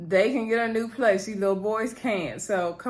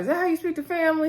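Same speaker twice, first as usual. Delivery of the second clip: high, fast indignant mom scolding; 8 semitones higher, much faster than usual. Retold or imitated. Mother scolding her son over the phone, high and fast. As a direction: snap at him like an offended mother.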